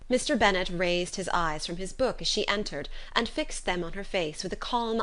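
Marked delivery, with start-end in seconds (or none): none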